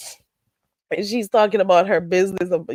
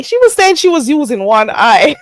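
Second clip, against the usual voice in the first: louder, higher, breaking into laughter; amused outburst; 6 semitones higher, 6 dB louder than usual. Real, not acted. Streamer retelling her drunk friend's line, loud and amused. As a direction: crow it, about to burst out laughing.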